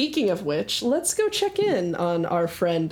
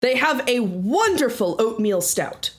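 grand, lively adventurer voice; hearty tavern regular. Acted. Leandros telling his friend his favorite drink, hearty and grand. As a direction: relish the order with theatrical flourish.

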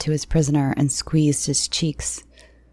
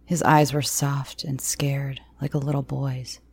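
plain reading voice

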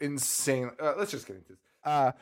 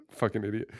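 normal talking voice

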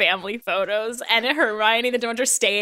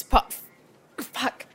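usual speaking voice

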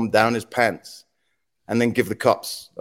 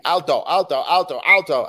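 higher, repeated mock police shout; mock scream; 5 semitones higher than usual. Police bearing down on the fishing boat, half-hearted screamed orders. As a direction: imitate officers screaming a halt order. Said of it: "they're just screaming".

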